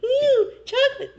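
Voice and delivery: high, squeaky party whoops of a tiny ant; mock ant cheering at a donut party. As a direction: whoop like an ant at a sugar rave. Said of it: "can you please act out an ant having a donut party?".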